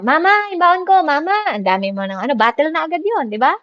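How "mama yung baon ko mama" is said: put-on high, whiny kid calling for mama; nagging child. Distracted child interrupting prayer, high whiny call. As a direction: whine for your allowance like a child.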